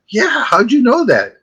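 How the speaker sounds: high, slow, drawn-out astonishment; hammed-up incredulous question; mock amazement. Imitating amazed client of fake psychic.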